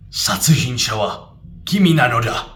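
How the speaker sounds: loud, direct anime-style delivery; dramatic anime line. Shown as a demonstration. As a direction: declare it like an anime hero. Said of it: "They aren't gonna say".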